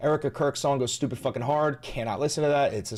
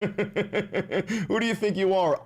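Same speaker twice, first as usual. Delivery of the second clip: slow, laughing taunt; laughing mock challenge; 4 semitones higher, much slower than usual. Teasing a chatter, slow and laughing.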